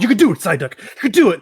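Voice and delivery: rally a struggling Psyduck; loud mock pep talk to Psyduck; cheering on. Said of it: "and you're like".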